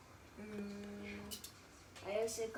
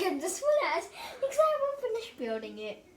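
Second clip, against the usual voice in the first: excited; 10 semitones higher, 5 dB louder than usual. Real, not acted. Rushed explanation.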